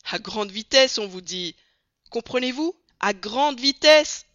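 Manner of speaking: plus fort, à grande vitesse martelé lentement; le colonel s'emporte contre Passepartout; insistance emportée. A narrator's reading. (insistance furieuse et lente)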